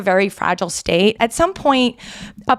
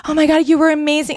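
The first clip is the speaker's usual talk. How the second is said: high, quick mock gushing; gushing fangirl; 6 semitones higher, faster than usual. Guest imagining herself fawning over the actor, fangirl gushing. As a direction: gush like a starstruck fan. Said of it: "like, a fangirl".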